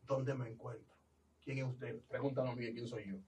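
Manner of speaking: dazed. Retold or imitated.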